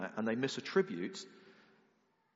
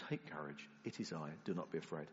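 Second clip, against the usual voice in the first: soft reassurance; 7 semitones lower, 9 dB quieter than usual. Retold or imitated. Jesus reassuring the scared disciples.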